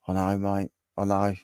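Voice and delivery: ordinary delivery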